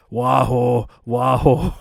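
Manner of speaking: lightly imitated cartoon villain catchphrase; Wario. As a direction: mimic a coworker doing a game sound. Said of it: "he would always do the noise of Wario".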